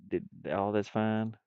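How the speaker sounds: usual speaking voice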